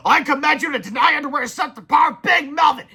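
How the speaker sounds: loud, high commanding shout; villain shout. A narrator's reading. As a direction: bellow the order. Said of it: "he shouted".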